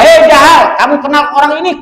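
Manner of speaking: sharp, high, loud challenge to Abu Jahal; the Prophet angry. Acted. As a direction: confront him in open anger. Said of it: "Marah Kangjeng Nabi". (Nabi marah menegur Abu Jahal dengan keras)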